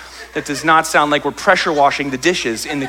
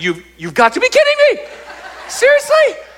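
much higher, louder cry of disbelief; outraged disbelief; 4 dB louder than usual. Retold or imitated. Son finding dad's new dishwasher, high outraged disbelief. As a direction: squeal it like you can't believe it. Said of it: "I walked in, I was like".

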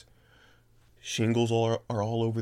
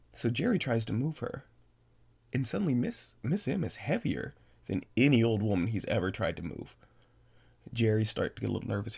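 ordinary delivery, nothing marked

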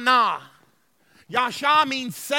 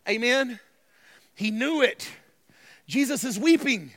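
ordinary talk

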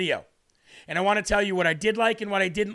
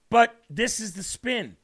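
ordinary talk, nothing marked

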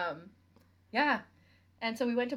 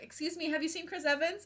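calling out; 9 semitones higher than usual. Retold or imitated. Re-enacts asking strangers from car.

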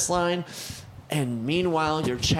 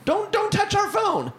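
mock angry shout; 3 dB louder than usual. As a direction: shout like guys pinning the thief down. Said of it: "And they're like".

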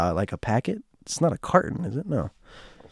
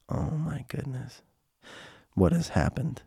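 retold dismay; 2 semitones higher, 12 dB quieter than usual. Narrator hears Joanne threatening a cop, quietly dismayed. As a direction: think it aloud, much softer.

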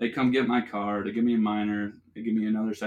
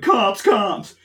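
cousin's frantic warning, much higher and louder; panicked yell; 9 semitones higher, 10 dB louder than usual. Retold or imitated. Cousin spotting the cop car, yells a panicked warning. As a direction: shout the alarm at the cop car. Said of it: "my cousin's like".

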